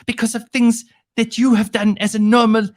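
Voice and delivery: shrill mock child's tantrum; bossy little princess. Mocking Greta's outrage at George.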